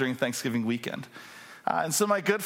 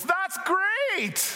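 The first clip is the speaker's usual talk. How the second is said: cheery exclaim. Retold or imitated. Mom cheerfully misunderstanding.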